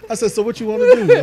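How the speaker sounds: high, loud words through laughter; laughing while talking. Real, not acted. Laughing while retelling talk.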